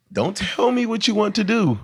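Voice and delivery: high-pitched acted pushback; mock retort. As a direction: throw it back in a high, drawn-up voice. Acted retort in a quoted reply.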